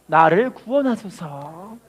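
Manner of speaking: very slow, deliberate recitation to repeat; slow call and response. Shown as a demonstration. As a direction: draw every word out for the church to follow. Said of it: "따라합시다".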